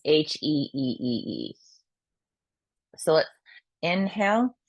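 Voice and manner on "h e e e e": long breathy heee; drawn-out heee. Shown as a demonstration. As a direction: stretch a thin heee out. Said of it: "the triple burner is".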